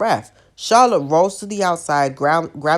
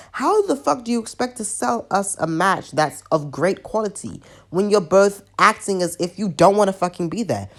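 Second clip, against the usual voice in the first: normal talking voice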